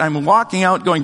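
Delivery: normal talking voice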